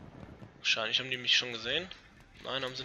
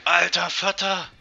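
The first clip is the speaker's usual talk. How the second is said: amazed; 4 semitones higher, 8 dB louder, slower than usual. Real, not acted. Reacting to a game moment.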